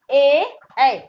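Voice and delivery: ए then ऐ, said clearly and firmly; ए and ऐ demo. Shown as a demonstration. Teacher contrasts ए and ऐ for confused pupils. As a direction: contrast ए with ऐ from throat and palate. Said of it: "देखिए".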